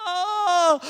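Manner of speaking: high, loud yelp of surprise; startled yell. Retold or imitated. Dad yelping as the card hits him, startled. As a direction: yelp as something hits you.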